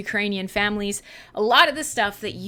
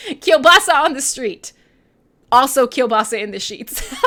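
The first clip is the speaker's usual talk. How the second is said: words laughed out, louder; laughing; 3 semitones higher, 7 dB louder than usual. Real, not acted. Host laughing while making a cheeky kielbasa joke. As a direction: giggle through the joke. Joking, laughing through lines.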